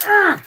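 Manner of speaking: loud sharp cry of pain; pained grunt. Real, not acted. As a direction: yelp as the finger hurts. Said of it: "ripping the end of my finger off".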